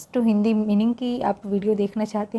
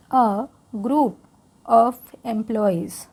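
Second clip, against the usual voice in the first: slow, careful read-aloud for learners; English read-aloud for Hindi-speaking students, slow; much slower than usual. Shown as a demonstration. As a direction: read it slowly so learners can follow along. Reading text slowly for learners.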